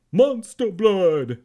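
loud, slow, drawn-out cry; excited shout. A narrator's reading. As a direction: bellow it like a thrilled kid. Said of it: "he cried".

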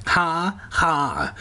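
sarcastic fake laugh; dry, sarcastic "ha, ha". A narrator's reading. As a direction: say the laugh as words, mockingly. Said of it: "they both said sarcastically".

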